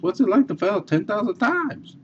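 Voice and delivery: high, teasing reporter voice; a reporter needling Edison; reporter's question. Retold or imitated. Reporter asks Edison about his failures, pitched high.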